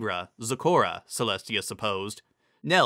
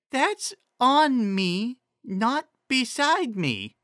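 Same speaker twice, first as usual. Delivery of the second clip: high, slow, simple-minded pony voice; high slow Ditzy; 13 semitones higher, much slower than usual. A narrator's reading. Ditzy reading Trixie's riddle to the group, high and slow. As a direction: drag it out high and dopey.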